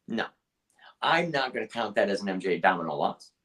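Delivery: normal talking voice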